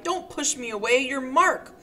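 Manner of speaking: a rising, frantic plea; pleading insistence. Acted. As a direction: insist desperately, refusing to let go. Helen clinging, insisting he's Mark.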